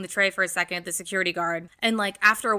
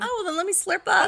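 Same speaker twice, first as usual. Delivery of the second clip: giggling, pitched up; laughing; 9 semitones higher, 3 dB louder than usual. Real, not acted. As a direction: giggle through the line. Laughing about finishing the wine.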